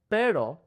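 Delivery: pero, the tongue flicking up once; single tap R. Shown as a demonstration. Teacher modeling the tap for students. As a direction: snap the r in pero in one tap. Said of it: "the tongue comes up really fast".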